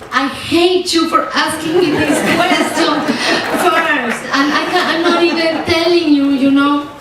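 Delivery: high, laughing through leftover tears; teary laugh at the interviewer. Real, not acted. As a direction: laugh it off while still emotional. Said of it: "laughing through tears at interviewer".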